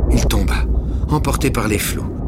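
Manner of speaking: breathless storyteller racing through the fall; tense story narration. A narrator's reading. Dramatic fall into river.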